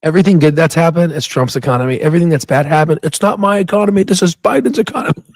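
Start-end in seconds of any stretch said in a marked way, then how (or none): 3.0-5.2 lightly voiced old grandpa, higher; a hint of a whiny old man; Trump impression. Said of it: "sounds like an old grandpa".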